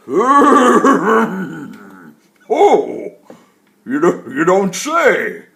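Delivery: put-on loud, jolly old tree voice; woody tree voice. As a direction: speak like a giant, cheerful old tree. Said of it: "deep, woody-sounding words came out".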